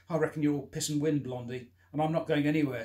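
a man taunting Blondie to bluff him off; loud bluffing taunt. Acted. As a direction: bluster to hide fear. Bluffing the attacker.

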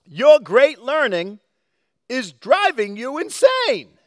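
mock outrage at Paul; slow accusing shout; read-aloud shout. A narrator's reading. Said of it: "he shouted".

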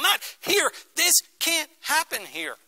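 high-pitched, stunned protest; shocked disbelief. Retold or imitated. Onlooker reacting to news of the attack, shocked disbelief. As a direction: recoil in disbelief, voice shooting up.

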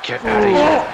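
angry shout. Acted. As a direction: throw him out with your voice. Player yelling at rival wrestler.

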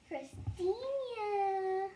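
very slow, drawn-out name; long, lingering taunting call; teasing call. Acted. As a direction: stretch the name out as a lure. Calling sibling to tease her.

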